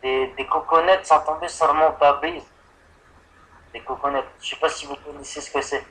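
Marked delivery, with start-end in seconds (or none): none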